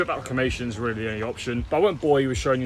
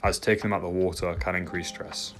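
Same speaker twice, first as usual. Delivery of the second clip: voiceover narration; 4 semitones lower than usual. Acted. Voiceover narration over footage.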